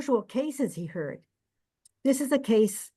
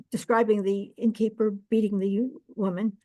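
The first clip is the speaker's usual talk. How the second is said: usual speaking voice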